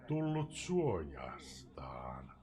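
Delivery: slow, hushed whisper; slow ominous whisper. Acted. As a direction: breathe the words as if afraid. Poem's dark stanza line.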